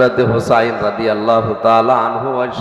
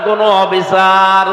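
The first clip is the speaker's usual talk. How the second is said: loud, high sustained call to the crowd; louder prompt; 6 semitones higher, 5 dB louder than usual. Shown as a demonstration. Preacher urging listeners to answer louder, loud rousing call. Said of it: "আরো জোরে বলেন".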